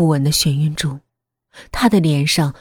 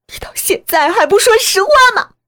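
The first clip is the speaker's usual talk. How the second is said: high, loud accusing cry; furious demand; 6 dB louder than usual. Acted. Daughter demands mother finally confess, in a high furious cry. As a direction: demand the truth at the top of the voice.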